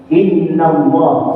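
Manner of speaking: slow Arabic "Inna Allah" with a doubled n; Arabic recitation. Shown as a demonstration. Teacher modelling an Arabic phrase, slow and deliberate. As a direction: draw out the "nn" in "Inna". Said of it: "Kalau di Indonesia kan sesungguhnya".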